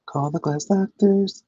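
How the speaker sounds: high, slow sing-song ad voice; mock jingle voice. Streamer mimicking a glass repair ad to viewers, sing-song. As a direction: sing it out like a radio ad.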